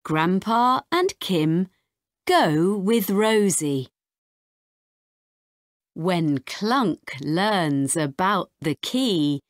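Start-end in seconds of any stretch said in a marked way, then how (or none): none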